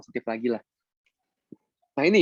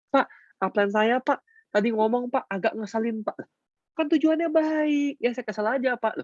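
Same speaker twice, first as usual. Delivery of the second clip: whining member; 7 semitones higher than usual. Retold or imitated. Member whines to coach about an annoying upline, higher. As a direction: whine to the coach like a sulky recruit.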